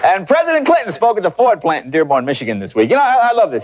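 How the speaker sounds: ordinary talk, nothing marked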